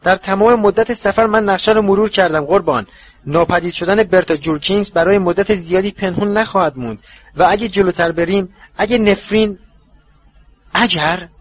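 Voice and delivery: a timid servant reporting in; servant's voice. Acted.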